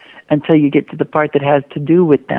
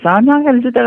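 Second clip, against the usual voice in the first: put-on protesting kid; 9 semitones higher, faster than usual. Kid protests in broken record.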